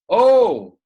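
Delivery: sudden high, loud "Oh!"; playful hammed-up yelp; mock yell. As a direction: yell it out like a startled boy. Acted yell in example sentence.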